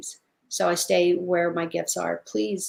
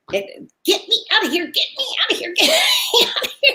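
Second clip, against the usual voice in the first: squeaky high pet voice, laughing through it; guinea pig voice; 13 semitones higher, much faster than usual. Acted. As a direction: play the frantic guinea pig begging to leave, much higher. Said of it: "and it's yapping".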